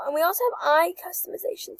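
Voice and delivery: ordinary talk, nothing marked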